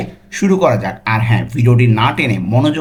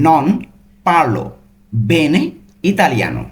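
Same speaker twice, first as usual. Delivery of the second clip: slow, separated Italian words; much slower than usual. Shown as a demonstration. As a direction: give each word on its own. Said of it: "প্রত্যেকটা কিন্তু ভেঙে ভেঙে বললাম".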